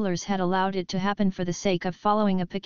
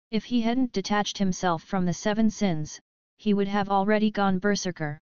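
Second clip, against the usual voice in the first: plain reading voice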